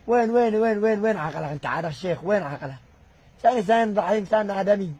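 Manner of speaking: loud, high angry tirade; furious shout. Real, not acted. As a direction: hammer the repeated وين at him. Berating the sheikh's judgement. (صراخ غاضب متكرر)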